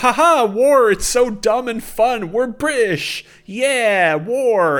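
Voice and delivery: high, loud mock British lad voice, sweary and cocky; mocking British accent. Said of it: "and it's like".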